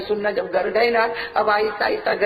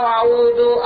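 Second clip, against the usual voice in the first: a louder, drawn-out first syllable of आऊदु, like a teacher calling it out; teacher booming आऊदु for a new pupil to copy; recitation demo; 6 dB louder, much slower than usual. Retold or imitated. Maulana shows a new pupil how to recite, louder. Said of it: "इसको बताओ".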